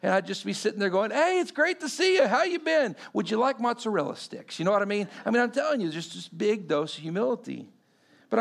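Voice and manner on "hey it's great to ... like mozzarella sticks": fake cheerful. Mock cheerful greeting to ex-girlfriends.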